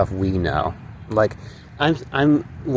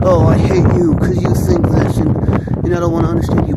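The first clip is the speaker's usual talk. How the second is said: mocking whiny outburst; 6 semitones higher, 4 dB louder than usual. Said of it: "They're just gonna be like".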